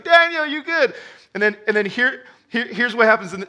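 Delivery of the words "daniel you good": king calling out. Retold or imitated. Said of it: "and he's like".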